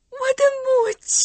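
high, loud call; joyful cry. Acted. Girl calls to her found mother, high loud cry. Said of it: "也叫出了声".

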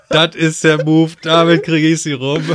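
laut, höher und gedehnt nachgeäfft; spöttisches Nachäffen. Retold or imitated. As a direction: mach dich lautstark über seinen Plan lustig. Spottet mit nachgeahmtem Gedanken des Freundes. (spöttisch nachgeäfft)

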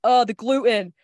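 light send-up of fussing over food; mock dismay. Retelling own worry about the bread, mock dismay. Voicing own fussy complaint.

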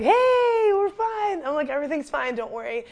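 high, bright-voiced reassurance; forced cheerful reassurance. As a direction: brush it off to calm the family. Reassuring family after accident.

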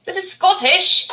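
high, slow fake Scottish accent. Shown as a demonstration. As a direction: stretch it out in a put-on Scottish accent. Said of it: "No, that was Scottish".

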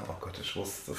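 whispered aside; whispering. Real, not acted. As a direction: whisper it to yourself. Muttered aside.

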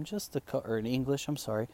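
usual speaking voice

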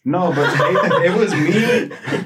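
laughing through the words; giggling out an admission. Real, not acted. Laughing at his awkward photo.

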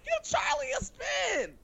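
high, shouted urging; excited shout. Real, not acted. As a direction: belt it out to convince her. Urging friend to listen.

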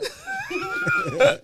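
very high burst of laughter; high squeal of laughter; shrieking laugh. Real, not acted. Cracking up at the story.

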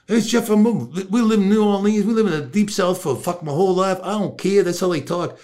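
high-pitched acted reply; imitating a Southern man's explanation; Roosevelt.

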